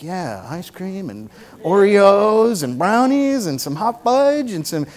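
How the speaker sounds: slow, drawn-out list of treats; dreamy dessert craving. Acted. Preacher voicing listeners dreaming of ice cream, savoring slowly. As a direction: savor each treat, stretching it out. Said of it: "You were like".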